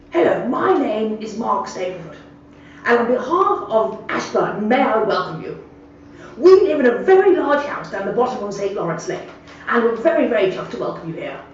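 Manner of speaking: hammed-up posh old man greeting a newcomer; posh gentleman. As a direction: lay on the posh welcome like a retired squire. Same greeting again.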